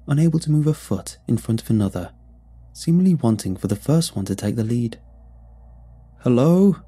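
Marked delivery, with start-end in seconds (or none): 6.2-6.8 loud, high call with a rising end; shouting. A narrator's reading. Brom calling out to the silent town, shouting. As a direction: shout hello into an empty town. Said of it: "Brom shouted as loudly as I could".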